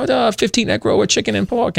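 a deliberately bad phone-order accent; bad Chinese restaurant impersonation. Prank caller to his teacher doing a bad restaurant accent. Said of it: "really bad Chinese restaurant impersonation".

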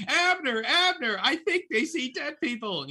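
high-pitched nosy neighbor impression; Gladys Kravitz. Nosy neighbor calling Abner about the psychics next door, hammed-up high voice. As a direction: call out high and alarmed, like a meddling neighbor. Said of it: "refer to her as Gladys Kravitz".

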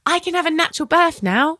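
high, bright cheer of delight; thrilled. Retold or imitated. Mum retelling her joy at the good scan, high cheer. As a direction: cheer like you just won. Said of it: "I was like".

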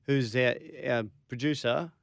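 ordinary talk

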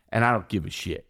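normal talking voice

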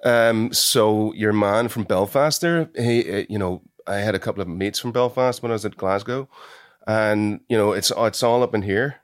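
Belfast accent, chatty; Northern Irish accent. Shown as a demonstration. Actor showing host on request, Belfast accent. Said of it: "Can you do an Irish accent".

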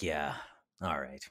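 low, quiet, flat-falling yeah all right; bored cop. Retold or imitated. Cop lets the speaker off, low and quiet. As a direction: shrug it off like a cop letting you go. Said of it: "And he's like".

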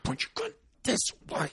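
urgent breathy whisper, pushed like a yell. Retold or imitated. Hunter urging Reese to turn his gun, whisper yelling. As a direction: hiss the order without voice. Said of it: "whisper, whisper yelling".

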